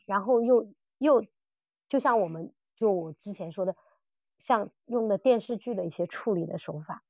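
ordinary talk

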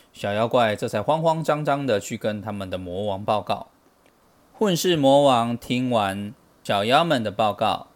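plain reading voice

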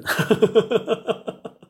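high loud laugh. Real, not acted. Finishing the first story, loud laugh.

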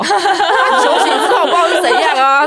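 laughing through the words; high laughing voice. Real, not acted. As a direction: giggle while telling it. Laughing while recounting mom.

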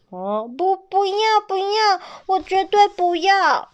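refuse, on the edge of tears; baby begs not to be left; pleading refusal. A narrator's reading. Child refuses parting.

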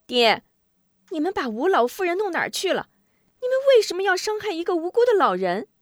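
high, fast voice of an indignant daughter; reproachful daughter. A narrator's reading. Daughter confronting her father over an old woman, high and reproachful. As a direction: demand an answer, rushing and climbing in pitch. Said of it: "张秀秀反问张雄".

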